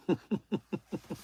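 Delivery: a short high embarrassed giggle at himself. Real, not acted. Streamer giggling at his own mod slip, high laugh.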